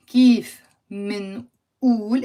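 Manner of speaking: spaced out word by word, drawn-out vowels; slow model phrase for learners to repeat; slow repeat-after-me. Shown as a demonstration. Teacher leading learners to say it out loud, slow demonstration. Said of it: "say with me out loud".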